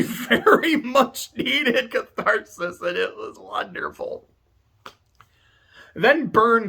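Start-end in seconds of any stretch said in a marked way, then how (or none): 0.0-4.3 a teary laugh, higher and slow; laughing through tears. Real, not acted. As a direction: laugh it out while still moved. Laughing at own emotion.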